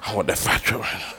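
put-on soft, fading child wish down to a whisper; mock whispered longing. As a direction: wish it under your breath. Kid whispering his wish.

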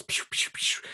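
quick unvoiced "pew" bursts; imitated zipping noise. Host showing listeners his burst of energy, imitated zipping noise. Imitates zooming through the day.

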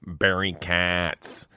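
slow, drawn-out imitation of a name recording; conference-call name announcement. Comedian imitates Barry joining the conference call, drawn out. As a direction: say it very slowly, like a caller's recorded name. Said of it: "someone's entered the call".

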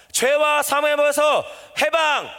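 high, drawn-out victory cry; rallying proclamation. Shown as a demonstration. Preacher leading congregation in proclaiming together, rallying cry. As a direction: lead the crowd in a slow triumphant chant. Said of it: "같이 우리 선포합시다".

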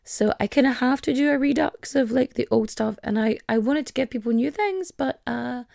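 ordinary talk, nothing marked

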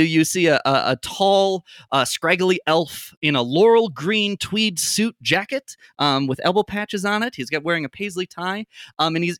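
ordinary talk, nothing marked

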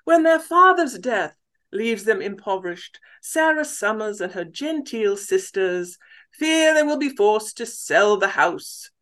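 reading in a put-on British accent, a touch high; shy attempt at a British accent. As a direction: mimic a polite British English reader. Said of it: "can you mimic a British accent".

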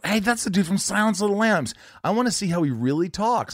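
retold burst of excitement; excited recognition. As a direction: act out spotting a familiar actor. Retelling his own excited discovery.

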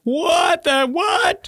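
very high, drawn-out mock astonished cry; retold disbelief. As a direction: stretch it out high in exaggerated disbelief. Clock now shows three fifty.

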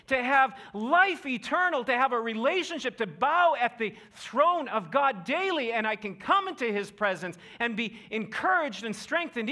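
high, loud, fervent preaching outcry; passionate plea. Retold or imitated. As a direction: plead with the king to be free. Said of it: "He's basically saying".

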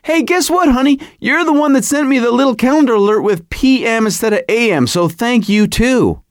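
worked-up mock scolding; mock screaming rant. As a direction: act out an indignant comeback. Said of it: "Then I'm screaming at her".

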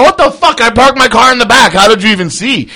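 high-pitched, indignant mock panic; retold alarmed outburst. Caught man answering his buddy's phone call, mock panic.

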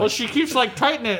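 ordinary talk

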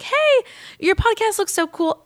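high-pitched, chirpy mock message; retold enthusiastic greeting. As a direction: imitate someone gushing in a high voice. Retold friendly message from former boss.

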